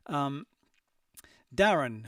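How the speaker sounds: ordinary talk, nothing marked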